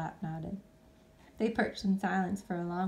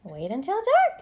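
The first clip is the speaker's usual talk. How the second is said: put-on young bat; 8 semitones higher, 9 dB louder than usual. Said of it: "Stellaluna said".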